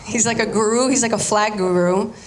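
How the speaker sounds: ordinary talk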